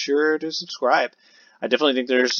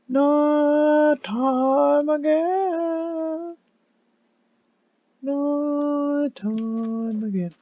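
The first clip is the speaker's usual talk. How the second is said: slow, much higher drawn-out wail; groaning at the night; mock lament; much slower than usual. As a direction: stretch it like a ghostly moan.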